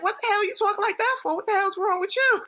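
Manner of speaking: high-pitched mock indignation; imitating appalled sister. Sister questioning her new way of talking, indignant. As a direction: challenge it high and incredulous. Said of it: "my sister came to me, she was like".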